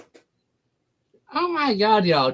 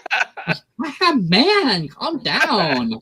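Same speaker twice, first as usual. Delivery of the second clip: drawn out slowly, laughing high; laughing slow mock rebuff; 9 semitones higher, much slower than usual. To her cohost, slow laughing tease. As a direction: stretch it out, giggling.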